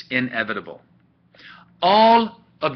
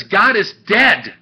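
slow, loud, higher declaration of "God is dead"; emphatic quotation; 6 semitones higher, 8 dB louder, slower than usual. Retold or imitated. Dramatically declaims Nietzsche's famous quote.

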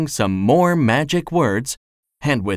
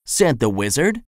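usual speaking voice

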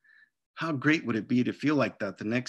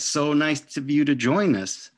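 sarcastic teacher; 3 semitones higher, 6 dB louder than usual. Acted. Teacher scolding late pupil.

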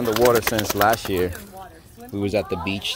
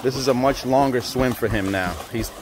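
ordinary talk, nothing marked

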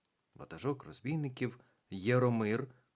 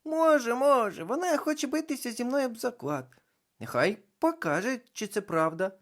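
much higher, louder eager voice; excited clown; 7 dB louder than usual. A narrator's reading. Клоун підбурює натовп, високий збуджений голос. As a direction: egg the crowd on.

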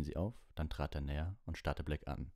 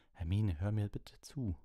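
raised, higher-pitched plea; urgent call; 5 semitones higher, 3 dB louder than usual. A narrator's reading. Lupin pleading with Hermine, calling out. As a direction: call out to make her listen. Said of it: "rief Lupin".

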